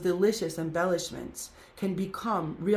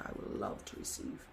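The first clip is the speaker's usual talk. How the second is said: whispered wish; 12 semitones lower, 15 dB quieter than usual. Real, not acted. Teary-eyed aside about attire.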